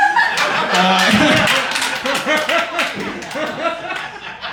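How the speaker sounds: long helpless laughing fit; long fit of laughter. Real, not acted. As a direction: lose it completely.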